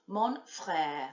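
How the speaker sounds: frère with a throaty French R at the back of the throat; guttural French R. Shown as a demonstration. Teacher showing pupils the guttural R in frère. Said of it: "nice little guttural sound with our R's".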